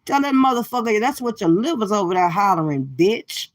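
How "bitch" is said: usual speaking voice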